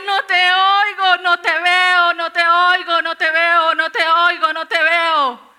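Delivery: high, slow, sustained full-voice shouting; desperate screaming. Retold or imitated. Woman alone in a closet crying to God, screaming. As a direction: wail each phrase at the top of your voice. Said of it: "Y gritaba y le decía".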